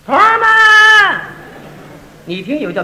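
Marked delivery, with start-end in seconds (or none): 0.0-1.2 Beijing call. Shown as a demonstration. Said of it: "北京人一叫".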